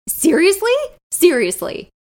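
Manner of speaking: high, loud disbelieving cry; shocked and mortified, pitch jumps up; stunned Seriously? said high and loud; disbelief. Acted.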